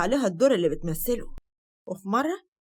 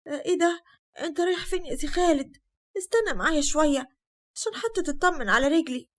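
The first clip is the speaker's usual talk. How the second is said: coy pleading; 7 semitones higher than usual. Acted. Hind coaxes Khaled to stay.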